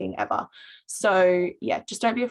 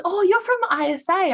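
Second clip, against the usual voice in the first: much higher, louder gleeful recognition; excited recognition; 12 semitones higher, 6 dB louder than usual. Retold or imitated. Girl recognising a student leader, high thrilled exclamation. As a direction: imitate the girl spotting you, thrilled. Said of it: "She goes".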